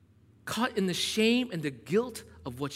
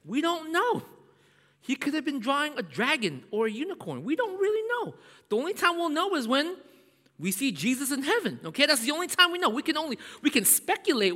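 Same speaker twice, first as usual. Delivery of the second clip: excited; 9 semitones higher than usual. Real, not acted. Preacher jumps high and excited.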